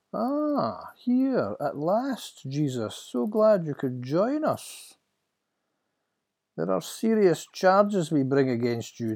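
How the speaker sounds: high-pitched, drawn-out mock welcome; sneering high priest. High priest to his prisoner at last, mockingly gracious. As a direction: toy with the prisoner, then interrogate.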